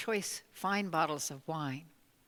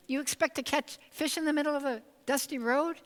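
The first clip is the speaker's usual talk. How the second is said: incredulous; 7 semitones higher than usual. Acted. Judge incredulous at the farmer.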